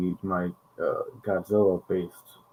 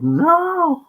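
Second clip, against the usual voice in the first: anguished "No!"; 6 dB louder than usual. Retold or imitated. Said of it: "and it's just like".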